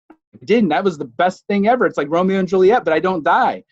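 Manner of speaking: crowing that he cheated death; gleeful. Real, not acted. To the audience, thrilled he survived, excited.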